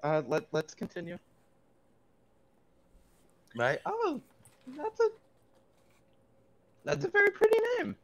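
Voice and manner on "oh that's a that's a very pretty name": much higher and faster, strained cheer; character voice. Acted. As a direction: force cheer in a high voice. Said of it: "character reacts to a name".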